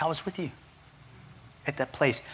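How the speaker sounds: soft, gentle reassurance; tender voice of God. Retold or imitated. Preacher voicing God reassuring his younger self, tender and soft. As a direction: hush it down, comforting.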